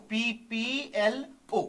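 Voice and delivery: "PPLO" drawn out letter by letter; spelled out slowly. Shown as a demonstration. Teacher spells the term for students, "PPLO" letter by letter.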